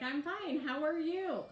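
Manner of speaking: cheerful honest I'm fine; genuinely fine. Shown as a demonstration. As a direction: answer like you truly mean it. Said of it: "If you was really fine, you'd be like".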